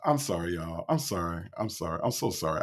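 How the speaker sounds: low, soft, winded apology; subdued apology. Real, not acted. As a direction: apologize under your breath, pitch dropped. Apologizing for laughing so hard.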